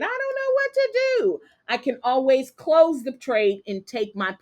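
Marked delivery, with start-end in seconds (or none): 0.0-1.4 high-pitched helpless whine of mock despair; mock frustration. Trader acts out losing her nerve on a pullback, whining high. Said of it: "I am getting frustrated".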